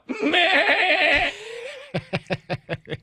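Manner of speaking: long high quavering bleat; sheep bleat. Retold or imitated. Dad answering his embarrassed kids, bleating.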